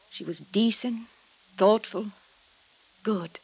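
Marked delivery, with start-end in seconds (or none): none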